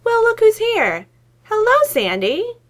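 overdone fake delight; forced cheer. A narrator's reading. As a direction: greet through a pained grin. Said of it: "pained grin stretching across his face".

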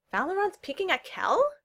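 high incredulous question; exclaiming. A narrator's reading. Newcomer behind the door, exclaiming in surprise. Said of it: "another voice behind Neil exclaimed".

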